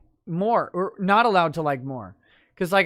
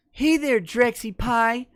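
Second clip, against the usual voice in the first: creepy over-friendly teacher, pitched up; Mister Y; 6 semitones higher than usual. Acted. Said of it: "says in Mister Y voice".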